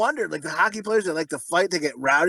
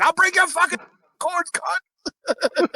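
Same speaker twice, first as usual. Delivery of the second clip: mock threat; 10 semitones higher, much slower than usual. Husband voiced choking her.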